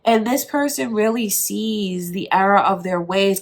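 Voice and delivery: normal talking voice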